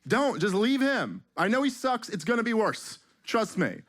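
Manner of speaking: high, pleading warning against overthrowing the dictator; pleading advice. Retold or imitated. Comic to the government, high pleading warning. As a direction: beg them to leave the dictator alone, pitched well up. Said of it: "you're just like".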